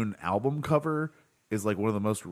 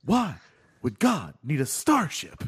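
William Shatner impression; 3 semitones higher than usual. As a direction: pause after "Why" like Kirk. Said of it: "every Shatner impersonation is based on that moment".

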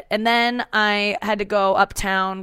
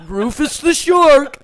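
slow, louder shark callback; shark voice again; 7 semitones higher, 8 dB louder, slower than usual. Pretend. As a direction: ham up the Rufus voice. Sign-off callback.

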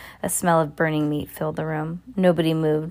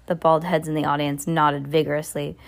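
ordinary delivery

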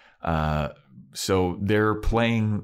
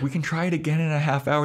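quick mock reassurance; 10 semitones higher, faster than usual. Repeat, faster higher.

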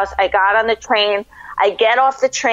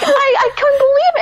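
much higher, voice caught with tears; real emotion welling up; overwhelmed, near sobbing; tearful. Real, not acted. Reliving tearful meeting.

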